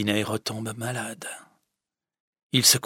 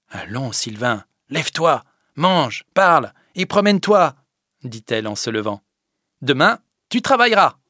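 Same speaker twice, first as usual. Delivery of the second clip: higher, louder rousing orders; encouraging Fadette; 4 semitones higher, 7 dB louder than usual. Acted. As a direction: rouse him to get up. Fadette lui commande de se lever. (la fadette qui l'encourage, énergique)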